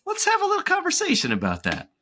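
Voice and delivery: upbeat coach stepping in after a heavy set. Retold or imitated. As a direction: talk like a chummy trainer reining you in. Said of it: "have coach come in and say".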